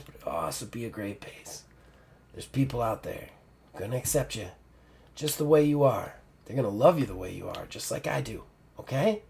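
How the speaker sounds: put-on soft, fading old woman's voice; dying mentor, tender. Dying mentor thanks Ruby, soft and tender. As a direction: speak gently through the weakness.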